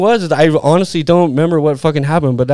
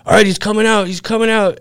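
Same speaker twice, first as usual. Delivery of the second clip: higher, clipped radio call; police officer radioing that the suspect is coming out, higher; cop on radio; 6 semitones higher than usual. Retold or imitated. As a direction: announce it over the radio like a cop.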